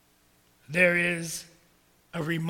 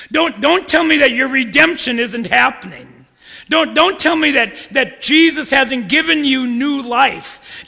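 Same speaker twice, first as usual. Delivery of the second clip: louder, higher, hammering each don't; fiery preaching; 3 semitones higher, 10 dB louder than usual. Acted. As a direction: rebuke the church at full force. Preacher rebukes the congregation.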